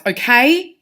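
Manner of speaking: loud, high lift on "okay"; questioning rise. Shown as a demonstration. Teacher reading Ron agreeing reluctantly, rising on "okay". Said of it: "ask it like it's a question".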